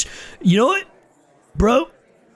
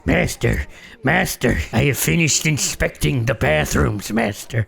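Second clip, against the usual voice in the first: lower, softer servile dwarf voice; dwarf servant; 5 semitones lower, 3 dB quieter than usual. Acted. Snorri the dwarf reporting to his master, low and meek.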